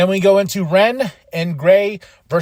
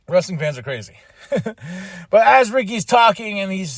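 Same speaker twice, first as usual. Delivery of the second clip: normal talking voice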